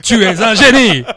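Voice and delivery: put-on booming presenter praising his child; doting dad stuck at sportscaster volume; Alexandre Ruiz. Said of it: "t'imagines Alexandre Ruiz dans la". (papa qui parle en présentateur tonitruant)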